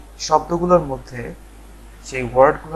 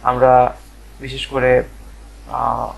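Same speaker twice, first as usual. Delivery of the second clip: normal talking voice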